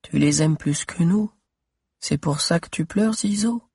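voix d'enfant qui interroge doucement; petite voix de fillette. Acted. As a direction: une petite fille triste. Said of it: "une petite voix". (fillette qui demande à Zizo, petite voix)

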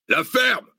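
high-pitched angry yell; yelled order. A narrator's reading. Mercenary chief silences the chanting captives, yelling. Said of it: "hurla Zeytin".

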